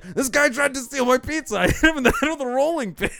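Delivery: high, laughing pizza man telling the story; lightly voiced old Italian, amused. Pizza man tells the kids why the guy bled, high and laughing. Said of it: "It's a fucking old Italian guy".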